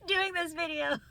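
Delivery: high, laughter-squeezed voice; real giggles breaking into speech; laughing. Real, not acted. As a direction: laugh your way through the words. Laughing at ASMR boyfriend videos.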